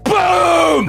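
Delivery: shouted boom sound effect; sudden explosion noise. A narrator's reading. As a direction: bark out the blast. GM narrating explosion.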